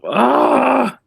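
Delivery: high startled "ah"; startled cry. Real, not acted. Streamer startled by a surprise tip, high cry.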